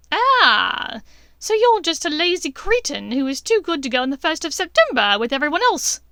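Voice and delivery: high, loud sneering insult; scornful witch. A narrator's reading. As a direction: jeer at him. Bellatrix insulting Draco.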